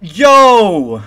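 high, loud, stretched-out yell; stunned shout. Real, not acted. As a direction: cry out in amazed joy. Reacting to second legendary.

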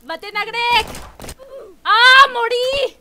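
high, loud drawn-out cry; excited game yelling. Real, not acted. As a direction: shout out in the heat of play.

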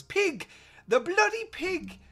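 very high falsetto kid, mock Irish accent; hammed-up high-pitched Irish accent; terrible Jamaican Irish accent. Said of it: "my terrible Jamaican Irish accent".

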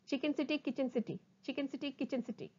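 rapid, run-together syllables; sped-up tongue twister. Shown as a demonstration. Teacher races through the twister for students, fast. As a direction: rattle it off twice. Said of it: "speed up".

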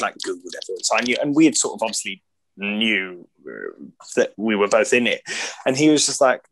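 ordinary talk

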